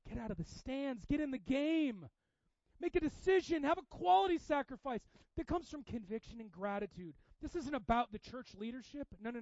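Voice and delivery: rally them from the pulpit; building pulpit call to action; rallying preaching. Real, not acted. Preaching at full force.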